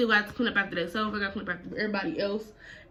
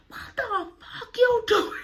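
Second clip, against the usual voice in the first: mock mama shriek; much slower than usual. First take of repeated line.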